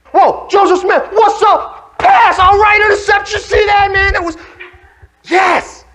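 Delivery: very high, loud celebration; ecstatic fan shouting. Acted. Player watching an interception from the bench, ecstatic shouting. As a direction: scream the play like a wild fan.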